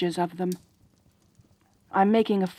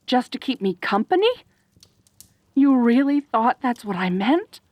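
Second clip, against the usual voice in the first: usual speaking voice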